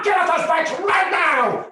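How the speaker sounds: screamed high and loud; furious shouted command; angry ranger. Retold or imitated. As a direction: yell the order, out of patience. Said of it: "he's so angry".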